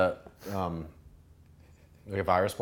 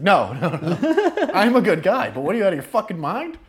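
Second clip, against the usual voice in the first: laughing protest; 10 semitones higher, 9 dB louder than usual. Retold or imitated. Assuring the girl he won't hurt her, laughing disbelief. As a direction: laugh while insisting he's harmless.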